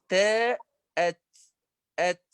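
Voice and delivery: أت with a hard stop and puff of air; letter demo. Shown as a demonstration. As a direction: stop hard on the ت in أت, then breathe. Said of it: "والتاء كذلك". (نطق التاء الساكنة بشدة وهمس)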